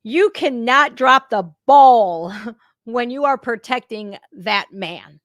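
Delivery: sternly insist, punching key words loudly; loud, emphatic warning with a brief laugh; emphatic admonishment. Acted. Streamer imagining a scolding of the security detail, emphatic admonishment. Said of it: "I'm like".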